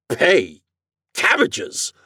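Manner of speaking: incredulous one-word questions, leaping high; astonished gasp. A narrator's reading. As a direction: gasp each word in disbelief. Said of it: "gasped Mister Morehouse".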